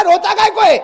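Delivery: loud high scolding question; loud impatient why are you crying; exasperated shout. Retold or imitated. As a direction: bark at him to stop crying. Preacher scolds the crying man.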